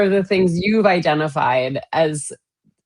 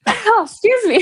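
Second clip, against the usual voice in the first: flustered after sneeze; 4 dB louder than usual. Real, not acted.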